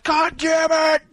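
high, strained comic curse; mock pissed-off. Moviegoer pissed all over again about passes, mock pissed-off. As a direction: burst out in hammed-up exasperation. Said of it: "and be pissed all over again".

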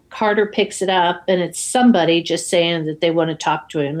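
ordinary talk, nothing marked

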